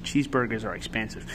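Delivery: plain reading voice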